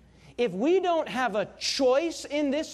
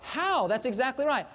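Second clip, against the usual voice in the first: ordinary delivery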